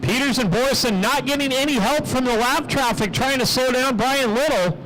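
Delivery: high-energy track announcer, pitch climbing as the lead changes; live race play-by-play. Real, not acted. Track announcer calling laps and passes for the crowd, excited play-by-play. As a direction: call the race with full announcer projection.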